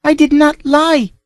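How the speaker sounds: put-on high, slow pleading girl voice; tearful denial. A narrator's reading. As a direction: beg through tears to be believed. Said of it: "sobbed Tina".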